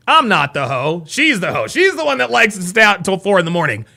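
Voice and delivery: ordinary delivery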